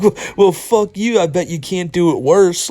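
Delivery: hammed-up pouty defiance; mock sore loser. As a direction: drag it out like a sulky loser. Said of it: "stand up and go".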